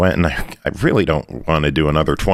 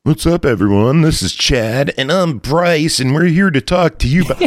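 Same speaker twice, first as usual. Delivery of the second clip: podcast bro intro; 9 semitones higher than usual. Pretend. Mock podcaster intro on plane.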